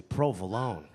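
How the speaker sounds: low, sultry crooning pronunciation; sexy. Shown as a demonstration. Comic showing off how he says it, sexy. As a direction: say it low and seductive. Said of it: "it's sexy".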